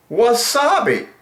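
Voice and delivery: lilting high wasabi; high sing-song word. Real, not acted. Savouring the word.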